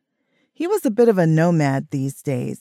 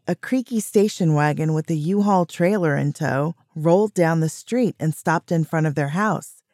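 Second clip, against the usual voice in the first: ordinary delivery, nothing marked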